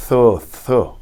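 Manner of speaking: Tho breathed, tongue between the teeth; Yemenite TH. Shown as a demonstration. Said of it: "eles lê T H". (Tho com th suave, como no inglês)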